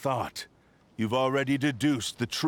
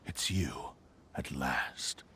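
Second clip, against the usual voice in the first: whispered, dropping softer at the end; hushed recognition; 8 semitones lower, 6 dB quieter, slower than usual. Acted. Swordsman greeting the foe he sought, hushed whisper. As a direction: breathe it as you finally see him. Recognizing his old enemy.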